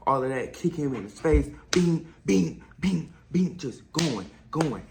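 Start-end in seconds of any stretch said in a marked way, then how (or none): 1.7-3.6 punch noises. Retold or imitated. Imitating the punches.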